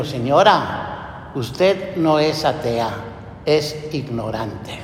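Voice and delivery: slow, wry, dropping low to the end; ironic put-down. Retold or imitated. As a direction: let the irony sink in slowly. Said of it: "con un poco de ironía". (con ironía pausada, bajando la voz)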